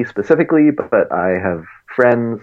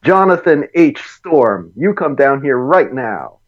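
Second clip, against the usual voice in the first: yelling across the room; 6 semitones higher, 3 dB louder, slower than usual. Retold or imitated. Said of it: "when their parents were upset".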